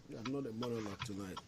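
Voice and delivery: barely audible. Real, not acted. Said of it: "You gotta speak up a little louder".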